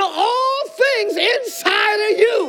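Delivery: fired-up pastor shouting to the congregation; yelling in the pulpit. Real, not acted. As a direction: hype preacher yelling. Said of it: "I'm sorry I'm yelling".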